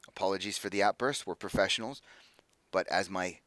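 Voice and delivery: low, soft professional apology; reluctant apology. Acted. Mercenary leader apologizing for her colleague's outburst, reluctant and subdued. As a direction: concede it quietly and grudgingly. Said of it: "there's a reluctancy when she says it".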